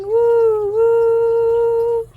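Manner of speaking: high, loud whistle hoot; train whistle woo. Acted.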